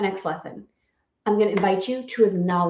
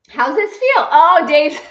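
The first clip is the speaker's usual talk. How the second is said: high, louder giddy call; excited laughing; 8 semitones higher, 7 dB louder than usual. Real, not acted. Wife calling to her husband, high and giddy. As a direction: tease him with a laugh.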